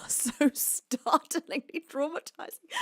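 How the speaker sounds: can barely get the words out for laughing; laughing while reading. Real, not acted. Reading a viewer's mustache comment, laughing.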